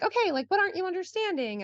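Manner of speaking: put-on chirpy, fast, sing-song prompting of a stuck kid; eager mom helping son. Mom eagerly asks her son what he's missing, high and quick. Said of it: "I would be like".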